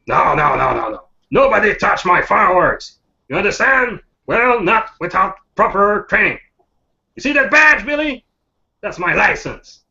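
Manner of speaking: put-on high-pitched, indignant old-timer; cranky old man. Old pyrotechnician defending his fireworks to Billy, cranky. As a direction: scold the boy like a proud, cranky expert. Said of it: "go cranky, like, instantly".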